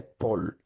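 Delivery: lateralized R in "pol"; Dominican pol. Shown as a demonstration. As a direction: say "pol" with a final L in place of the R. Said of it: "en vez de decir por, dice pol".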